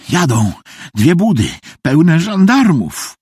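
high, breaking into fragments; frantic explaining. Acted. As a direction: blurt the alarm in bursts, pitch climbing. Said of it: "tłumaczył bezładnie". (bezładne, gorączkowe tłumaczenie)

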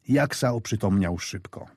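usual speaking voice

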